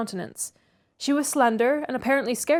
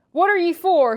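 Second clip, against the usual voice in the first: high, loud shout in dialect; loud, high challenge in improvised dialect; 7 semitones higher, 7 dB louder than usual. A narrator's reading. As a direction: holler it as Joseph. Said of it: "he shouted".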